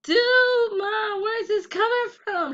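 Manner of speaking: high, loud bewildered cry; surprised protest. Retold or imitated. Daughter taken aback by her mother's lesson, high and loud. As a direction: thrown by the lecture, pitch jumps way up. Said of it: "And I was like".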